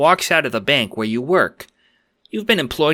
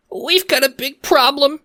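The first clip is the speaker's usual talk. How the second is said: much higher squeaky nervous croc voice; frightened little squeak. Acted. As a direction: squeak it out in panic. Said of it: "you squeak".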